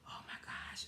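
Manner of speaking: whispered, breathless-sounding amazement; awed whisper. Pretend. Music lover moved by what they hear, awed whisper. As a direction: whisper it in mock awe.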